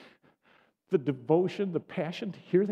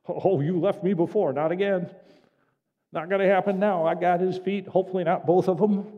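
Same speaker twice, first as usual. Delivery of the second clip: loud, higher, insistent protest; clinging protest; 4 semitones higher, 8 dB louder than usual. Acted. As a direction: refuse loudly to let go, half-comic. Acting Mary clinging to Jesus.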